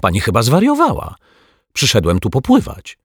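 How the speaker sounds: high-pitched indignant protest. A narrator's reading. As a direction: argue back, affronted. Indignant quarrel with cleaner.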